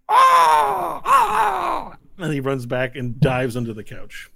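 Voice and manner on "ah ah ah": very high, loud squealing cry; piercingly high goblin screams; goblin shriek. Acted. Goblin spinning the gun, high squealed shrieks. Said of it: "And he's like".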